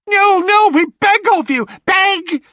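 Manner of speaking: high, loud crone begging; pleading hags. Acted. Sisters begging Perseus, high loud crone pleading. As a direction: beg like desperate witches.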